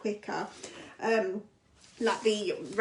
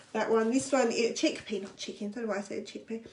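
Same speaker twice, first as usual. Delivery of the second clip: ordinary talk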